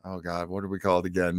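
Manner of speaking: usual speaking voice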